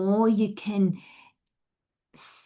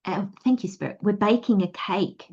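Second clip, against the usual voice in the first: ordinary talk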